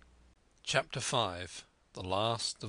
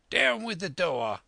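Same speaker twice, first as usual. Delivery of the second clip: high, loud barked order; shouted command, pitched high; commanding cry; 8 semitones higher, 5 dB louder than usual. A narrator's reading. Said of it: "he cried".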